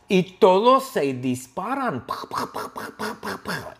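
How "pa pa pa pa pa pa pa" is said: breathy mouth gunfire, pa pa pa; whispered rat-a-tat of shots; gunshot noises. Acted. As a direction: fire off a quick burst of toy-gun sounds. Gunfire sound effect.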